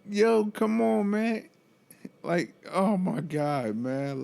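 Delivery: slow, high exasperation; drawn out come on, fed up; exasperated. Real, not acted. Exasperated at patient.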